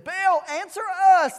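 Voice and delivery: loud, high cry to a god; preacher voicing a shouting crowd; acted shout. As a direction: call out pleadingly to Baal. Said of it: "they shouted".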